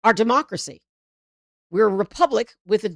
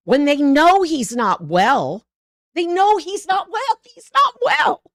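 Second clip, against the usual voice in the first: louder and higher, building into a shout; 11 semitones higher, 7 dB louder, slower than usual. Real, not acted. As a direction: hammer it home, rising to a yell. Ranting about Trump's health.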